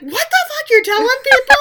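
very high, loud mock screech; shrieking imitation of an outraged woman. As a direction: screech it in exaggerated disbelief. Acting her outrage at being told.

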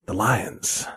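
low, hushed and deadly serious; hell of serious Walken. Retold or imitated. Previewing the serious Walken clip, low and hushed. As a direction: drop it down, grave and quiet. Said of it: "when he gets hell of serious".

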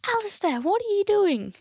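high, scolding mum voice; mother's high line. Retold or imitated. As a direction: call out like a shocked mother. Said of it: "my mother goes".

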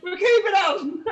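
high, loud rallying shout; hammed-up triumphant yell; crew yelling. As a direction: shout it as an excited crew, laughter close behind. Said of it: "Everybody starts yelling".

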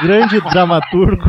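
loud laugh, then slow words through it; laughing shout into a mock praise; burst of laughter. Real, not acted. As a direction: crack up at the pun. Joke about Wolf Maia.

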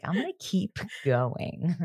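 slow, soft California drawl; a hint of a laid-back California accent, slow. Imitating Will in a slow, soft California accent. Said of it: "I was really vibing that accent".

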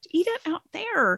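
high mock scolding at the squirrels.

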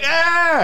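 mock high shriek of surprise; startled scream. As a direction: shriek as if caught off guard. Ex walks in, startled.

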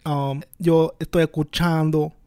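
hammed-up Puerto Rican Spanish; Puerto Rican accent. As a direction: play a Puerto Rican homie. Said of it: "talk in a Puerto Rican accent".

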